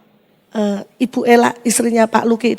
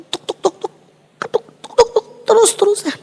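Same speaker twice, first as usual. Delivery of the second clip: knocking sound imitation; 8 semitones higher than usual.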